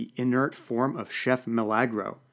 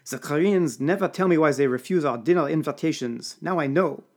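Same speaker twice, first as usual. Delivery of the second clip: put-on higher, louder French-accented grumble; 4 semitones higher, 4 dB louder than usual. A narrator's reading. Serge scorns the drunk chef, French accent. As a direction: sneer it with a thick French lilt. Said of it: "Serge voiced with accent".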